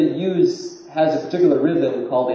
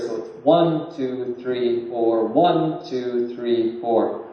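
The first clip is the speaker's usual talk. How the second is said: ONE stressed most and THREE lightly, counting one, two, three, four; counting beats with stress; slower than usual. Shown as a demonstration. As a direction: count out the beat, leaning on ONE and a little on THREE. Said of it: "with stronger emphasis on the first than the third".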